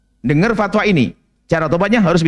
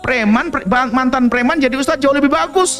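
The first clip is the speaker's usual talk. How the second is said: fervent preaching; 10 semitones higher than usual. Acted. Preaching with raised voice.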